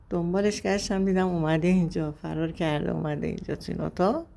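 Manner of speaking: normal talking voice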